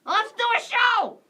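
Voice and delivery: high, loud, drawn-out rallying call; excited showtime shout. Real, not acted. Host launches the improv show, excited shout. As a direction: kick off the show with a big holler.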